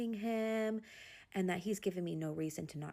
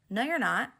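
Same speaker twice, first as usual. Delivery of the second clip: higher, louder retort, re-enacted; retold firm contradiction; 5 semitones higher, 7 dB louder than usual. Wife contradicting husband on FaceTime, retold firm challenge. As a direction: push back on an obvious lie.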